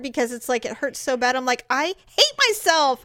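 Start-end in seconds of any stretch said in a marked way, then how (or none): 1.7-3.0 higher, drawn-out mock fury at oneself; hammed-up self-loathing; exasperated self-reproach. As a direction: wail it slowly in frustration. Re-enacts rage at own clumsiness.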